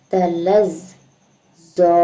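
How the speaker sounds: slow, drawn-out chanted recitation; tajweed recitation, slow and measured; Quran recitation. Shown as a demonstration. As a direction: recite it melodically, stretching each vowel. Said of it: "recites with long vowel".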